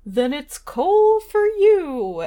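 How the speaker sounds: loud Santa voice threat; Santa scolding. Acted.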